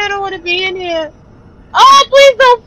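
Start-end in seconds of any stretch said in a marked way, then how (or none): 0.0-1.1 softer, fast frightened muttering; scared murmur. Real, not acted. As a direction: mumble it, fear draining away. Repeating, fear fading.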